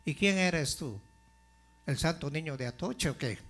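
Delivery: ordinary talk, nothing marked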